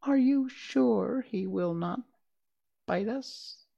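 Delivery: high, small nervous question; timid voice. Acted. Mouse queen peeking from the grass, high timid question. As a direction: ask fearfully, peeking out. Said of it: "asked in a timid voice".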